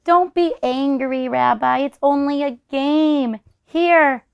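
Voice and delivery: slow, high, coaxing voice; calm a big angry animal; soothing granny. Acted. Grandmother soothes the angry bear.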